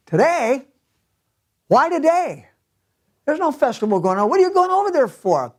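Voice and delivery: high, puzzled mock husband asking why today; husband's surprise. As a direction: play the baffled husband, pitching way up. Husband's puzzled retort in story.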